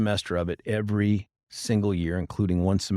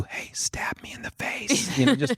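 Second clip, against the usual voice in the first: hushed, deadpan plea to be put out of misery; mock bored whisper; 6 semitones higher, slower than usual. Juror whispering to neighbour in a dull slideshow, whispered. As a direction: whisper it to the person next to you, pleading.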